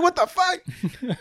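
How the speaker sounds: high, drawn-out mock shock; laughing disbelief. Husband imitating his wife's shocked reaction, laughing disbelief. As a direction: wail it slowly through laughter.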